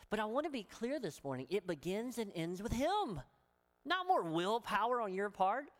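ordinary talk

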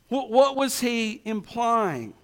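ordinary talk

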